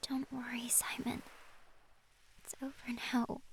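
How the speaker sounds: soft breathless comfort; soothing whisper. Acted. Lily soothing Simon. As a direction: whisper him calm.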